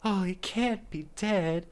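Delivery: stretched high-pitched lament. Retold or imitated. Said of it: "I love his voice when he does this".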